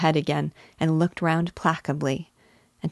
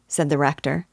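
ordinary delivery

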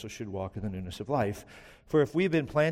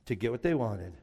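normal talking voice

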